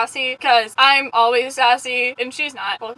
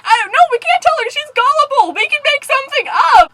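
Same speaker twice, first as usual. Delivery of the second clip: much higher, louder urgent arguing; mock frantic protest; 11 semitones higher, 5 dB louder than usual. Prankster argues against telling the victim, mock panic. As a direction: replay panicked scheming, hammed-up.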